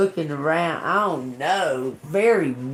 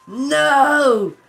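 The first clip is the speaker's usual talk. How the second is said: high, loud cry of no; mock despair, both words; anguished shout; 12 semitones higher, 9 dB louder than usual. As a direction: yell it in dismay. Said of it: "I was like".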